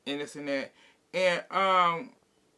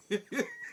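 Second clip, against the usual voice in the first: high mocking laugh; 9 semitones higher, 4 dB quieter than usual. Mimics Garcelle's laugh.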